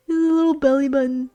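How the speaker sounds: quick, cooing little voice; cooing at the snow creature. Real, not acted. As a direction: gush over the tiny belly button. Baby-talking to Jack Frost.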